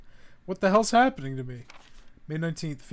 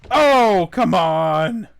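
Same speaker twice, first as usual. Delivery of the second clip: frustrated outburst; 4 semitones higher, 14 dB louder, much slower than usual. Real, not acted.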